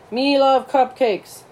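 high, slow, drawn-out monster voice; Cookie Monster. Acted. Oliver clowns for classmates over a cupcake, hammed-up monster voice. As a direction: slowly gush like a greedy puppet monster. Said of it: "That's Cookie Monster who talks like that".

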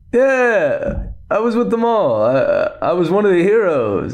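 high, bluffing mock Lando voice; mock Lando bragging. Lando claiming he was one of the heroes, high bluffing mock voice. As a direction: oversell it like a fake hero. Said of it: "main characters to be like".

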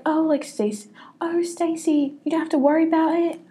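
high, lilting mock sweetness of a fussy matron; being annoying. Friend mocks Margaret reassuring Stacy, high annoying sing-song. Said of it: "I'm just, like, being annoying".

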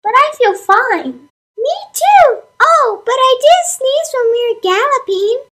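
squeaky, high, excited animal voice; bouncy little horse, thrilled about spring; high cartoon horse voice. Horses answer farmer.

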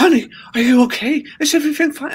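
high mocking imitation of a doting girlfriend; mock fussing girlfriend. Man mocking his ex's fussing: high mock girlfriend voice. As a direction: squeak it like a fretful partner.

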